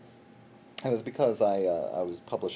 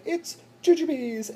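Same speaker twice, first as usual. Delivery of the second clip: excited; 8 dB louder, slower than usual. Retold or imitated. Presenting the gift with enthusiasm.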